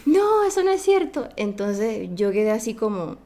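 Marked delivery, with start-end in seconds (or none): 0.1-1.3 high, loud, hurt denial; shocked protest. Retold or imitated. Little girl denying the kids' taunt, shocked and loud. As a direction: cry out that it isn't true, much higher and louder.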